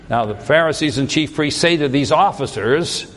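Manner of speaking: ordinary delivery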